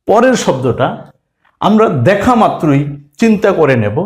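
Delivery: ordinary delivery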